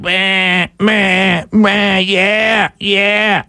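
drawn-out mocking sheep bleat. Host mocking blind followers as sheep, bleating. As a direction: bleat along like a following flock. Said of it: "there go the sheep following right behind".

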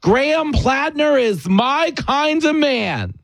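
slow, high mock Warren line; imitating Elizabeth Warren. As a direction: drawl it like a proud senator. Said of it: "she goes".

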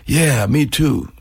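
slow, drawn-out laid-back reply. Retold or imitated. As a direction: imitate a musician's unhurried drawl. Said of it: "that's the way he talks".

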